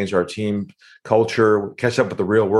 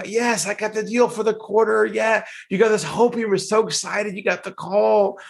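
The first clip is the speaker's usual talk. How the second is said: mock excitement; 12 semitones higher than usual. Salesperson telling team, mocked.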